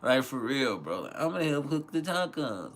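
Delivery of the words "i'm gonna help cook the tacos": high mock kid voice; put-on higher voice of a kid; mimicking son. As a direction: imitate an eager child, pitched well up. Mimics his son again.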